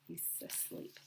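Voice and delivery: whispered so the wolf sleeps; soft whispered aside. A narrator's reading. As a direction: hush it like a secret. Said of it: "unvoiced, very soft".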